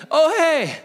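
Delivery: high-pitched, overeager cover-up; mock-cheerful lie. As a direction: chirp a fake excuse. Retelling his cheerful second lie.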